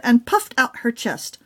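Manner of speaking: usual speaking voice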